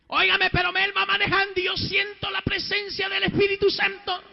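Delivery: high, loud testimony; full-force preaching. Real, not acted. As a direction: proclaim it with rising fervour.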